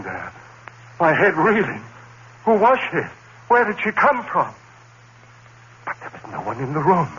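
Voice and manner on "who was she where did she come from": high, urgent frightened asking; panicked questions. Acted. Narrator alone in the room, frightened. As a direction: reel in fear. Reeling after the vision.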